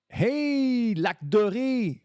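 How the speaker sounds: high, drawn-out shout; long, stretched high call. Pretend. Calling out Lac Doré.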